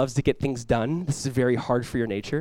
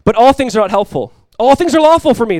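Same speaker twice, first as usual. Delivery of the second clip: high, loud proclamation; Paul quoting a slogan, loud and high; preached slogan; 7 semitones higher, 10 dB louder than usual. Acted. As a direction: call out the slogan to the room. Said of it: "they would say".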